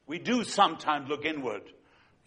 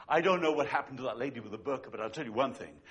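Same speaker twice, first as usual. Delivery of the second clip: normal talking voice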